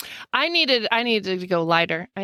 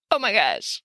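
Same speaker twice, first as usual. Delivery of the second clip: ordinary delivery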